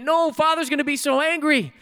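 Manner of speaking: high mock wail of dread; inconsolable boy. Boy to the neighbor offering help, mock wailing. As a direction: play a child too worked up to listen.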